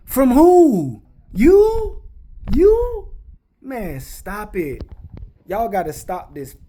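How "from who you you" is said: high, loud mocking disbelief at the brand; incredulous shout. Real, not acted. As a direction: scoff loud and high, you of all people. Ranting at the brand.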